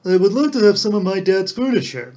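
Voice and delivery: a con man hamming up a son's grief; mock filial love. A narrator's reading. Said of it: "with inexpressible filial love".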